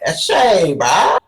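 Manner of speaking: Aussie eshay slang greeting, said loud. Retold or imitated. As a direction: call out "Eshe, bruh" like an Aussie eshay. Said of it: "I'm like".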